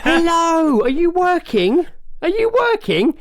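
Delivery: very high sing-song cooing; cooing old ladies. Retold or imitated. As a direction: coo it like sweet old ladies. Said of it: "they all coo at her".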